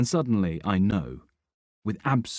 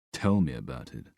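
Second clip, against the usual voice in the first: heavy, low sarcasm; weary sarcastic reply; 7 semitones lower than usual. A narrator's reading. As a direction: drip sarcasm, dry and low. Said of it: "voice heavy with sarcasm".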